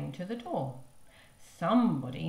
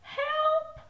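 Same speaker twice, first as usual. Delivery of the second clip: very high, louder cry for help; shout; 5 dB louder than usual. Acted. Mother shouting for help, high loud shriek. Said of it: "shouted Missus Blair".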